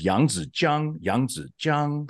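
"Yang, Zi, Jiang" syllable by syllable, down then up; tone demonstration. Shown as a demonstration. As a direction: end "Jiang" high and level after a dip. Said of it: "your voice goes down and up high".

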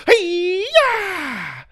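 high loud battle cry; kung fu cry. A narrator's reading. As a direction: shout it as you kick. Kung fu kick.

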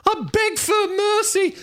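mock-terrified squeal, very high; 1 squealing coward. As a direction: shriek it like a cornered coward. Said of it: "he's like".

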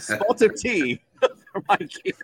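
light, slow mock British accent with a laugh. The host teases Mikey about his London voice in a laughing mock British accent. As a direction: tease him in a half-hearted British accent. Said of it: "do a London voice".

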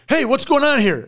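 high, loud challenge from a guard; security guard calling out. Retold or imitated. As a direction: call out like a startled security guard. Security guard calls out, retold.

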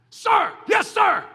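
loud, high, clear recruit shout; shouted sir yes sir. Shown as a demonstration. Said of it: "Clear and distinct".

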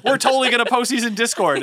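hammed-up cheerful vow we never keep; mock-enthusiastic podcast host; mock self-quote. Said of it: "And then we say".

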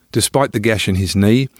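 ordinary delivery

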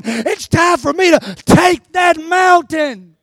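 loud shouted battle cry; full-force preaching. Acted. Preaches Caleb's resolve at full force.

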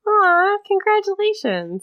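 high teasing aw congratulations; sing-song fake praise; mock congratulations. Real, not acted.

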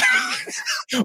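very high squealing laugh; burst of laughter. Real, not acted. Dad laughing at his son's reply, high squeal.

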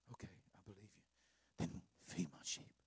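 very soft, low, near-whispered; soft low Jesus voice; gentle Jesus. Acted. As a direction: hush the command tenderly.

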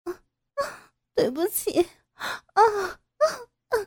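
high cries, some breathless, some tearful; miserable pleading. Acted. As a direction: whimper and beg for mercy. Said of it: "只能苦苦哀求着".

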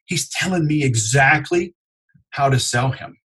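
ordinary talk, nothing marked

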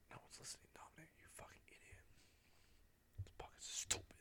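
whispered aside, berating yourself; barely audible, self-mocking whisper; whispered self-scolding. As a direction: scold yourself under your breath in a whisper. Mutters self-abuse as aside.

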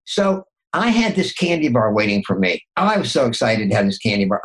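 ordinary talk, nothing marked

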